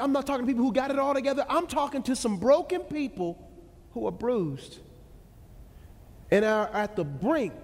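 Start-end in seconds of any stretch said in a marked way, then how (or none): none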